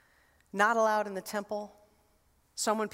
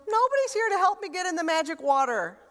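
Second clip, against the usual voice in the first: whiny excuse; 6 semitones higher than usual. Retold or imitated. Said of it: "This guy gives an excuse".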